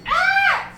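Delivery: high mock scream of a woman; woman's scream. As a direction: shriek it high like a startled woman. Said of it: "I heard a woman scream".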